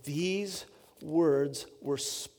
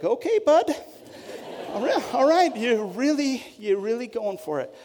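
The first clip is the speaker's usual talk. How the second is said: high, loud teasing "okay bud"; sarcastic wide-eyed astonishment, pitched high and loud; mock wide-eyed disbelief; 7 semitones higher, 4 dB louder than usual. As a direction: play the wife who can't believe what she's hearing. Wife Amy's wide-eyed reaction.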